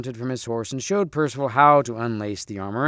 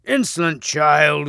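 slow, loud outraged cry; thunderous roar; 3 semitones higher, 5 dB louder, slower than usual. Acted. Red Knight rebukes the insolent youth, roaring. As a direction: draw it out loudly, like thunder. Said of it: "roared the Red Knight in a voice of thunder".

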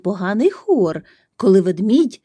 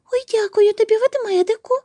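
very high-pitched little squirrel voice; squeaky squirrel; faster than usual. A narrator's reading. Squirrel thanks the bear, squeaking. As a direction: squeak the thanks out like a tiny animal. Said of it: "пропищала Білочка".

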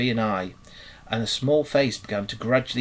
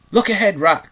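high, loud warning shout; urgent yell, pitch jumping up; sudden cry; 8 semitones higher, 8 dB louder than usual. A narrator's reading. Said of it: "cried the mole suddenly".

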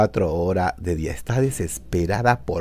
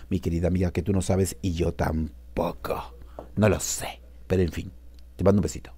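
hushed confidential whisper; secretive whisper to the friend; whispered aside; 4 semitones lower, 9 dB quieter than usual. Acted. Confiding on the phone.

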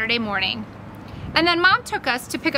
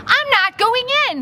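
high-pitched, heated refusal; raised voice, digging in; near shout; 11 semitones higher, 4 dB louder than usual. Acted. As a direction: nearly shout it, voice jumping up. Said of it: "he nearly shouted at me".

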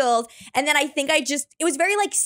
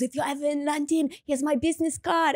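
softer French-accented imitation; 3 semitones higher, 4 dB quieter than usual. French guy visiting offers his card, French accent. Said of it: "I met this French guy".

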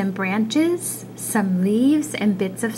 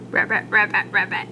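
frog croak; faster than usual. Acted.

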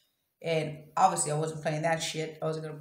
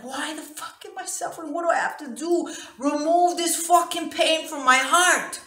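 high reenacted plea, building louder; anguished yelling; 10 semitones higher, 7 dB louder than usual. Woman reliving begging for the pain to go, anguished yelling. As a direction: plead at the top of the voice. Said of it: "I'm yelling, I'm saying all these things".

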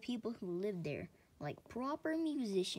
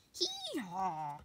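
donkey singing hee-haw; sung hee-haw. Acted. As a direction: sing the bray like a donkey. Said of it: "sings the donkey".

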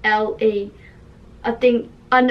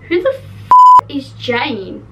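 loud, slow, baffled demand; who on earth is this, half shouting; real loud disbelief at her own story; exasperated outburst; 2 semitones higher, 8 dB louder, slower than usual. Real, not acted. Puzzled by her own writing.

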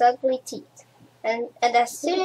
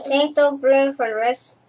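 usual speaking voice

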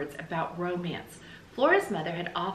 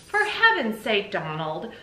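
exasperated; 2 semitones higher, 5 dB louder than usual. Acted. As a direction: burst out at the end of your patience. Exasperated reply in acted story dialogue.

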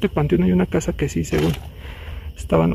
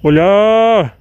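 loud drawn-out call into the dark; hollering to whoever is there; 3 semitones higher, 7 dB louder than usual. Real, not acted. Calling into a dark place.

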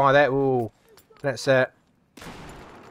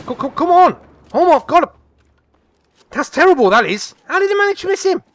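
disbelief; 12 semitones higher, 5 dB louder than usual. Real, not acted. Teammate misses easy kill.

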